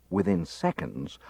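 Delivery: ordinary delivery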